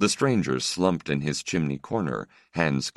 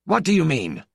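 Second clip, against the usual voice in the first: sharp, much higher and louder demand; 13 semitones higher, 5 dB louder than usual. Acted. Stranger to the old priest, sharp and high. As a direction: snap the question at them. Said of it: "The stranger's voice was sharp".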